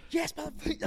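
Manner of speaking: high-pitched screamed reply; retold screaming. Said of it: "I'm screaming at this kid".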